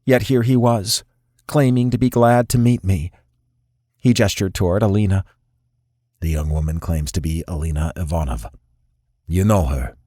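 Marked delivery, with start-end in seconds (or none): 6.2-10.0 low, measured voice of a dangerous crime boss; cold boss sizing up the prisoner; stern boss. A narrator's reading. Crime boss questions Nikolai about his fiancée, low and cold.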